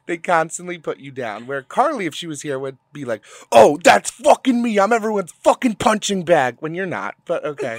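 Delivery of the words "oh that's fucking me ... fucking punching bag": loud, higher, dragged-out mock whining; mock victim complaint. Mimicking absent friend feeling picked on, mock complaint. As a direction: imitate a friend playing the martyr.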